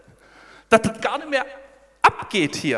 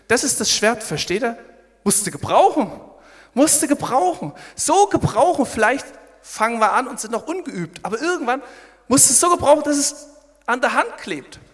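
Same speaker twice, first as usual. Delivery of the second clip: preaching at full force; 7 semitones higher, 7 dB louder than usual. Acted.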